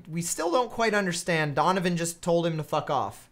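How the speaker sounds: ordinary talk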